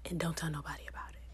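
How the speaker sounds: fast, secretive whisper; quick conspiratorial whisper. Acted. Conspiratorial aside.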